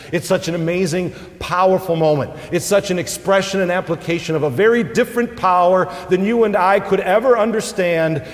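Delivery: lifting the congregation toward comfort, preaching hard; fervent preaching. Acted. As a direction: preach it out with rising force. Preaching at full force.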